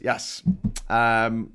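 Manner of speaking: ordinary talk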